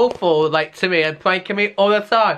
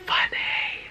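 whisper; 6 dB quieter than usual. Real, not acted. Aside to camera during prank.

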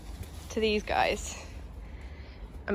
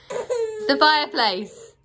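laughing; 5 semitones higher, 6 dB louder, slower than usual. Real, not acted. Laughing at baby.

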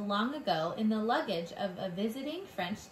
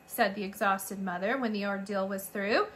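ordinary delivery

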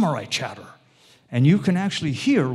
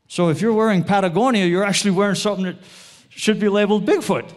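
excited punchline; 5 semitones higher, 5 dB louder, faster than usual. Real, not acted. Punchline about Patagonia brand.

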